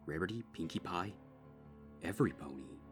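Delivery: plain reading voice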